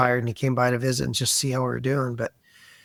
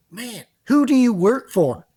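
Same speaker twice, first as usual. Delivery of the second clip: incredulous colleague; 8 semitones higher, 4 dB louder than usual. Retold or imitated. Voicing Oklahoma colleagues complaining.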